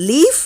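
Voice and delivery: Leaf high and rising, waiting for the answer; leaf lifted up high as a prompt; rising quiz cue. Shown as a demonstration. Teacher prompting pupils to finish the word, rising. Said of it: "அவங்க என்ன சாப்பிட்றாங்க?".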